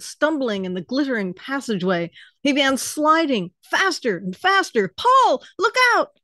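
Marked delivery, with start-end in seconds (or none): 5.0-6.2 a sharp, high, loud warning cry; alarmed shout. Acted. Chen tumbling down the passage, shouting a warning to Polly. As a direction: yell to warn her before you crash into her.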